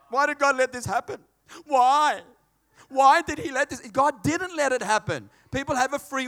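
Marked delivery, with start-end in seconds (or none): 0.1-3.8 anguished loud why. Acted. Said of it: "voicing the complaint".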